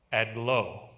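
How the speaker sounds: ordinary talk, nothing marked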